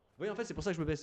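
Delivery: explaining from close to the microphone; mouth close to mic. Shown as a demonstration. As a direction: bend down right into the mic. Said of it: "je rapproche ma bouche du micro".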